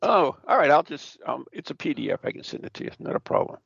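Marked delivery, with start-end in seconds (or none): none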